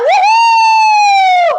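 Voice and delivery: high, loud joyful whoop; excited whoop. Real, not acted. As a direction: celebrate the finished set. Cheer at end of set.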